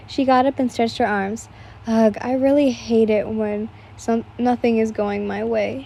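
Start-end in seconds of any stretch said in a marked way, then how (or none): none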